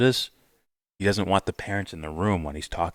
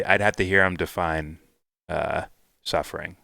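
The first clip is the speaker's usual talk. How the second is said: normal talking voice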